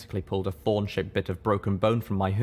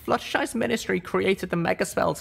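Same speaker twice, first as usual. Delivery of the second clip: reluctant admission; 11 semitones higher than usual. A narrator's reading. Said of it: "I admitted to him".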